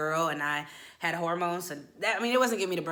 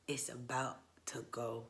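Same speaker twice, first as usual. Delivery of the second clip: low, soft, slow near-whisper; hushed foreboding; 7 semitones lower, 13 dB quieter than usual. Retold or imitated. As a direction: murmur it slowly as a warning. Hushed foreboding on seeing mama.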